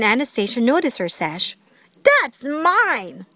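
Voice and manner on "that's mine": high, mild storybook yell. A narrator's reading. Anastasia grabbing the sash, mildly yelling. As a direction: claim it sharply without much volume. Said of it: "she yelled".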